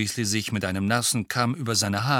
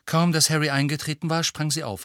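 ordinary delivery

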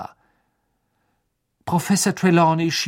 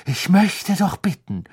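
Trelawney's reproach; 7 semitones higher, 6 dB louder than usual. Acted. Trelawney rebukes the pair.

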